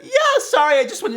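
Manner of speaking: high, loud, still laughing; laughing apology. Real, not acted. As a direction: apologize while cracking up. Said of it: "You okay, Group?".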